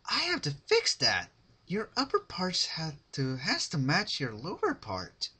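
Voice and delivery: high, loud, bubbly pony voice; sugary Pinkie voice. Acted. Pinkie deciding to fix Rarity, high and bubbly. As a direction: chirp it like a cheerful fixer-upper.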